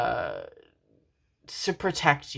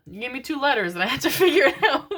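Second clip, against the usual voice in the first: high, quick laughing delivery; amused giggle at the puzzle; 10 semitones higher, 3 dB louder, faster than usual. Real, not acted. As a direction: laugh through the words.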